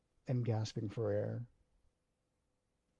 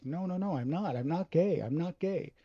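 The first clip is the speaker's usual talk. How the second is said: higher, louder frantic denial; panicked denial; 6 semitones higher, 4 dB louder than usual. Retold or imitated. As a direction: deny it in a panic.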